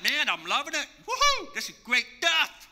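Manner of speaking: imitating Moses cheering, with a high woohoo; excited Moses. Moses cheering God's plan, playfully excited. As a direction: play Moses all excited and whooping. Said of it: "he's all excited".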